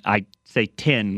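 "tin" said with a short i, like the metal; "tin" rhyming with pin, not pen; tin for ten. Shown as a demonstration. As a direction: say "tin" where ten should be. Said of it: "whenever I try to say the number ten".

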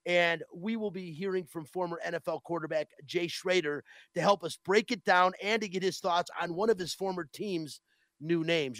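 ordinary talk, nothing marked

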